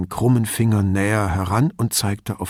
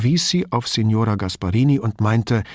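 ordinary delivery, nothing marked

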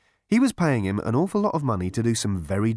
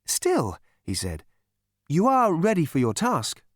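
much higher, sing-song soothing voice; chirpy psychiatrist; 4 semitones higher than usual. A narrator's reading. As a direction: purr reassurance to a violent patient.

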